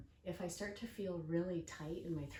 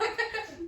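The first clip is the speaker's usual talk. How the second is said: burst of laughter; 10 dB louder than usual. Real, not acted. Laughing at daughter's slow shopping.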